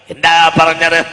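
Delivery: loud, slow preaching; ringing sermon voice; full-force preaching. Acted. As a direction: preach it to the hall.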